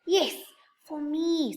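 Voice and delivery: plain reading voice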